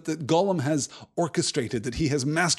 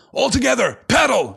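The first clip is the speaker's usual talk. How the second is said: loud urgent shout to the rowers; 10 semitones higher, 7 dB louder than usual. A narrator's reading. Boromir shouting at the Fellowship to paddle. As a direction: bark the order over the river. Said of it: "shouted Boromir".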